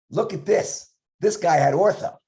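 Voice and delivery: high, eager show-and-tell; excited showing off. Retold or imitated. Resident showing the residents a student's bite, high and eager. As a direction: point out a find to the group.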